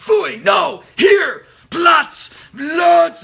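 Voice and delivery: high, loud barked commands at the dog; yelled dog commands. Retold or imitated. Handler yelling commands at his dog in training, full shout. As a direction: scream the commands at full volume. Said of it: "to yell and scream at our dogs".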